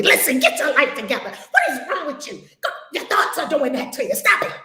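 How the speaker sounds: hammed-up tough-love scold; mock stern preacher. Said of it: "I was slicing people".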